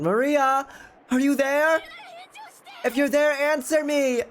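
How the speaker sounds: high, loud shouting into the storm; a mother yelling her daughter's name in the wind; calling out loud. Acted. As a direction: call for the lost child at full voice. Rosa calls for missing daughter.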